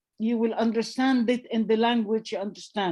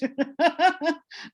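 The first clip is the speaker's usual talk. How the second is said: a short, high burst of real laughter; 6 semitones higher than usual. Real, not acted. Laughing while telling friends about a useless medium. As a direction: laugh at how silly it was.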